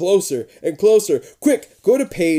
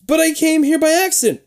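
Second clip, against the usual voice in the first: much higher, pleading; high pleading protest; 12 semitones higher, 4 dB louder than usual. A narrator's reading. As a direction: protest in a panic.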